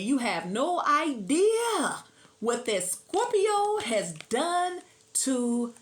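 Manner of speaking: a hint of a lovestruck fool, drawn out; smitten gush. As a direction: swoon over what Scorpio did. Smitten person gushing about Scorpio.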